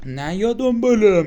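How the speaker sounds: loud, high, drawn-out mock scolding; exasperated scolding. Player tells the monster off for following him, exasperated scolding. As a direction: stretch it out in playful outrage.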